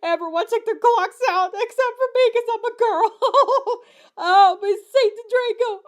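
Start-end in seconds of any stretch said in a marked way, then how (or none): 2.3-3.8 high voice breaking into laughter; laughing. Real, not acted. Reader cracking up at the absurd line, laughing high. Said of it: "laughing at the line". 4.2-5.8 mock scream. A narrator's reading. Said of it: "I screamed".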